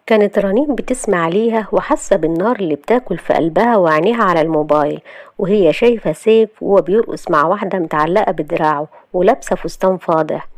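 plain reading voice

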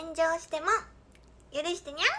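put-on playful high-pitched cat-girl plea; cutesy cat-girl. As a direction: plead like a cute kitten. Cutesy twin-tail cat catchphrase.

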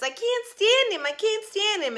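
very high shrill film-star quote; silent star squeal. Retold or imitated. Quoting Singing in the Rain to the viewers. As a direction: squeak it like the actress who can't do talkies. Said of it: "it's from Singing in the Rain".

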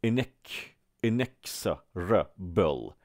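"inexorable" slowly broken up, "in-EKS, in-eks-ra-bol"; syllable-by-syllable model. Shown as a demonstration. Teacher breaking the word down for learners, slow "inexorable". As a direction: spell out "inexorable" by sound, very slow, with ks. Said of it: "پس میگید که inexorable".

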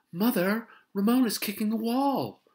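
high, loud tattling call; tattling sister. Acted. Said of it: "cried Tattletale Beezus".

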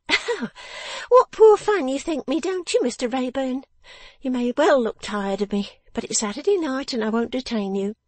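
high-pitched character voice, briskly dismissing a visitor; sharp little Miss Wren. Acted. Figure of Miss Wren in narration.

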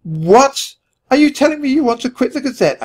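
loud, high burst of disbelief; outraged cry, then a fast high rush; shocked squeak. A narrator's reading. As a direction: squeak it out, appalled. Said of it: "I squeaked".

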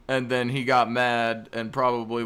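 ordinary talk, nothing marked

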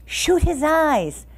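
high, drawn-out urgent command; crying out. A narrator's reading. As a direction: cry it out slowly to the archers. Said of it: "cried Sense Pass King".

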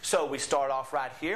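ordinary talk, nothing marked

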